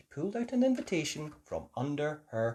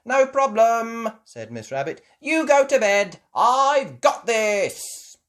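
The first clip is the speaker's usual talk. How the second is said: high, loud and slow, confident; slow confident promise, high and loud; Miss Rabbit reassuring; 11 semitones higher, 10 dB louder, slower than usual. Acted. Miss Rabbit sending the parents to bed, high slow reassurance.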